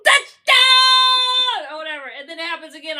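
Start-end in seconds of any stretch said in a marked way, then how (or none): 0.0-1.7 high, loud, stretched-out shout; drawn-out game-day yell; touchdown cheer. Acted. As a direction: bellow it like an ecstatic fan. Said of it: "when they score and you're like".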